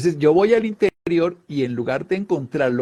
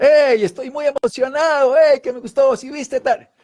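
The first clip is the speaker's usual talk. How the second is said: much higher and louder excited chatter; excited joy; 12 semitones higher, 7 dB louder than usual. Acted. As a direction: gush about something you loved. Así suena la alegría. (alegría emocionada, aguda y fuerte)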